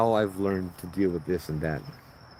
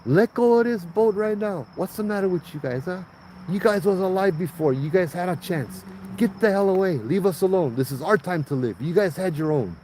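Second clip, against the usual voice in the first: retold yelling; 8 semitones higher than usual. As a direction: shout the ghosts off the boat, half-acted. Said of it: "starts yelling".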